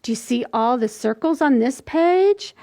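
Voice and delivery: normal talking voice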